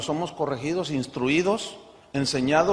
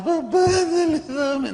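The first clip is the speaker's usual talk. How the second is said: put-on much higher, whining little kid begging; whiny child; 11 semitones higher, slower than usual. Father voicing his crying child's pleading, high whiny child voice. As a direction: beg like a tearful child. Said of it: "no me pidas con lágrimas".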